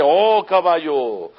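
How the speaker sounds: slow, loud, high call to the horse; preacher shouting at the horse within us, drawn out; drawn-out yell at a horse. Pretend. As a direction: stretch the shout out like a rebuke. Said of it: "le estoy gritando al caballo".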